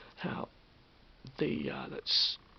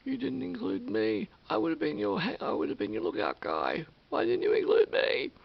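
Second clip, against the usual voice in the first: sad; 3 semitones higher, 7 dB louder than usual. Retold or imitated. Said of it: "she went sad".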